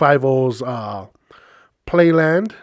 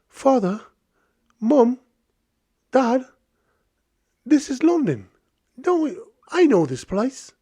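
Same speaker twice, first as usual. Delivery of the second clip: high-pitched mock London accent; attempted London accent; 9 semitones higher than usual. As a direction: imitate an excited London child in a put-on British accent. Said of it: "I don't know if that's a good accent".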